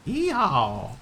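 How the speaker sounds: mock southern cowboy whoop; southern yeehaw. Streamer still joking about accents, mock southern whoop. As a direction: holler it like a cartoon cowboy. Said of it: "my deep southern accent".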